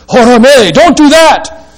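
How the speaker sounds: "Hora me, don't do that" loud and high; emphatic prohibition. Shown as a demonstration. Angel's Greek ban to John, loud and emphatic. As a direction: forbid it with full force. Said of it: "Very emphatic in the sounds".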